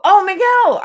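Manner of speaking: high, loud delighted "Oh, Miguel"; suddenly getting it, pleased; high bright surprise at the name; surprised realization. Real, not acted. Realizes viewer's real name.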